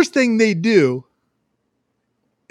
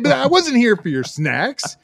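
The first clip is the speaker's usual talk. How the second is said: normal talking voice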